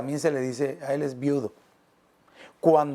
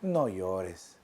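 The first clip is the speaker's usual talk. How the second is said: much lower and softer, gentle comfort; hushed consoling Lord; tender; 9 semitones lower, 7 dB quieter than usual. Acted. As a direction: say it softly to someone weeping. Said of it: "¿cómo habrán sido esas palabras del Señor Jesús?".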